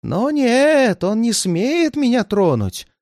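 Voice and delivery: high, slow, defiant outburst; agitated old man. A narrator's reading. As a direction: insist in a high, drawn-out voice. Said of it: "продолжал старик".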